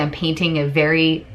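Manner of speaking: usual speaking voice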